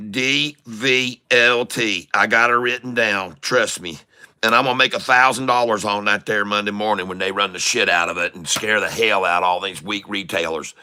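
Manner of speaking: ordinary talk, nothing marked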